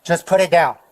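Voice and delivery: loud, insistent police order; firm command. Real, not acted. Officer to a man holding a gun, loud firm command.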